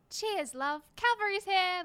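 loud, chipper British game heroine; Tracer. Acted. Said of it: "Tracer from Overwatch".

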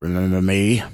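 snarled taunt, drawn from the mirror stranger; lightly menacing snarl. A narrator's reading. Stranger in the mirror taunts Scott, snarling. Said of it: "the stranger in the mirror snarled".

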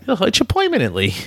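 high cartoon rabbit voice; squeaky old-timey Brooklyn cartoon voice; Bugs Bunny impression. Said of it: "It's Bugs Bunny".

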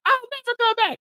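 very high, squeaky mock of a dramatic husband; high-pitched parody of a quitting husband; mock Gino. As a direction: squeal it like a petulant man storming out. Mocking Gino's dramatic exit.

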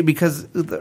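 ordinary talk, nothing marked

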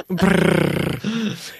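"brrr" with a long rolling trilled r; rolled Scottish r. Shown as a demonstration. Cohost mocking how Americans hear Scottish, "brrr" with a trilled r. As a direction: roll the r on "brrr" like a playful Scot. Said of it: "You need to brrr all over the place".

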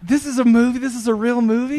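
high, loud incredulous outrage; disbelieving wife. Retold or imitated. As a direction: voice the wife's disbelief. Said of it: "She got so pissed".

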